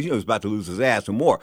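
ordinary talk

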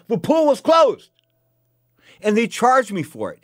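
loud, high-pitched, worked-up complaining; indignant rant. Real, not acted. Man grumbles about Las Vegas resort fees, real indignant rant. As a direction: rail against it, punching out each grievance.

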